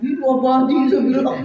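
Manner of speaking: loud, high teasing echo; mock amazement. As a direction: tease with exaggerated awe. Mother mocks claim of eternal love.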